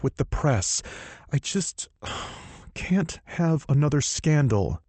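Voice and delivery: stamp your foot and vent; exasperated heroine. Acted.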